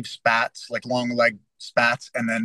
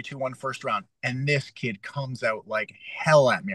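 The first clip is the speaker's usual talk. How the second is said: ordinary delivery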